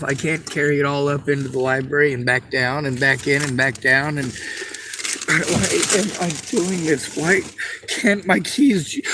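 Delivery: ordinary talk, nothing marked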